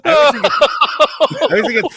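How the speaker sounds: high, loud burst through laughter; laughing while talking. Real, not acted. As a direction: start the thought still cracking up. Still laughing after the clip.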